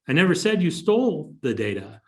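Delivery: "stole" stressed, line falling at the end. Shown as a demonstration. Host showing viewers the meaning change, stress on "stole". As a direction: put the weight on "stole". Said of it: "just by where I put my inflection".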